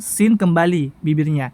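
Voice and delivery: usual speaking voice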